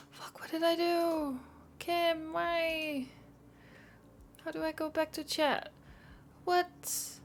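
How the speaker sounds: high, exasperated questions at a fouled-up screen; helpless, fed-up pleading; flustered frustration. Real, not acted. Streamer lost the chat window, pleads with Kim in high frustration.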